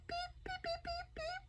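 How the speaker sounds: very high, very soft squeaky beeps; little mouse squeaks; tiny mouse beeps. Acted. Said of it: "made a tiny beep".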